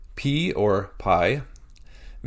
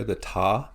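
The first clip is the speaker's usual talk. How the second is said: "ta" held up alone as the stressed syllable; single out "ta" with a full vowel; emphasized syllable. Shown as a demonstration. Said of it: "Our emphasis is on the last syllable".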